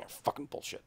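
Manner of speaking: whispered, barely audible swearing; a sour curse whispered to yourself; muttered curse. Retold or imitated. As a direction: hiss it under your breath. Muttering curse at blackjack dealer.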